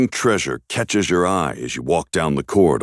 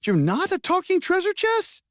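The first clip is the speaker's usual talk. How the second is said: high confused voice; 4 dB quieter than usual. Acted. Hero confused question.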